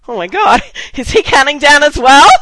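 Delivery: loud, high incredulous question, laughing; retold amazement. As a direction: exclaim it like you cannot believe he's counting. Retold amazement at his countdown.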